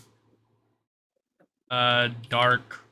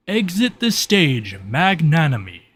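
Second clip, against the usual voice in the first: higher, louder theatrical command; activation command; 5 semitones higher, 6 dB louder than usual. Acted. Spirit calling forth his sword's release, theatrical command. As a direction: proclaim it grandly, falling at the end. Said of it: "he says".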